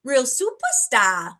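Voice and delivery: put-on slow quote of the old man's line; echoing a Maine accent lightly; hint of a Maine drawl. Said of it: "I love that you did the accent".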